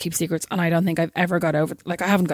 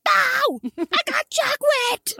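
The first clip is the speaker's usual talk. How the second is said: put-on very high-pitched, drawn-out kid squeal; imitated child blurting; much slower than usual. Child with chocolate giving away the secret, imitated high squeal. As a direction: blare out the secret like an excited child.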